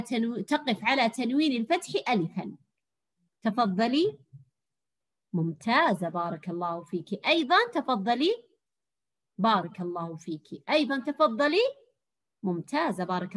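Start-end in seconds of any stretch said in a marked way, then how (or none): none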